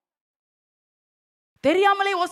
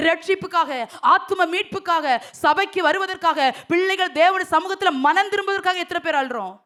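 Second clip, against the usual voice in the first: fervent preaching; 4 semitones higher, 5 dB louder than usual. Acted. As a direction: preach it out, pressing the congregation. Preacher building to a loud appeal.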